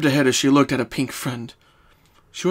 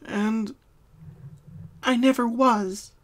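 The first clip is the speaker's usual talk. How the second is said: mild sad confession; 9 semitones higher, slower than usual. Acted. Pinkie voiced by narrator.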